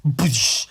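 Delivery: loud "boosh" explosion noise; imitated blast. Gun going off in the panel, mouthed blast. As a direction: burst it out like a gunshot.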